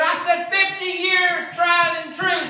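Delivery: high, loud, slow bellow; shouting again. Retold or imitated. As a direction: roar it out in exasperation. Husband shouting a third time.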